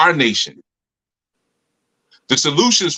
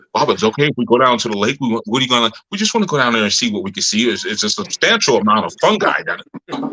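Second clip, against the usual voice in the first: put-on kids' proper talk; 5 semitones lower, faster than usual. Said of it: "That's how, that's how".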